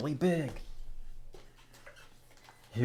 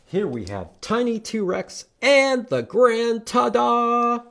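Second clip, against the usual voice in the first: higher and louder, slow showman reveal; grand announcement; 12 semitones higher, 8 dB louder, slower than usual. Acted. Teacher presenting the book to children, grand and showy. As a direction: announce the title like a ringmaster.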